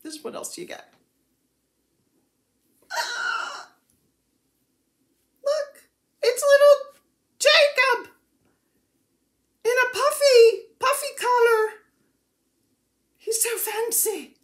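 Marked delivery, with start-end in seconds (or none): 2.9-3.7 high squeal of delight; excited gasp. Real, not acted. Reveals the surprise kit item. 5.4-11.7 high-pitched, gushing and loud; excited squeal. Real, not acted. Squealing over tiny Jacob figure. 13.2-14.3 high-pitched cooing delight; tickled and high-voiced; gushing. Real, not acted. Gushing over tiny Jacob figure.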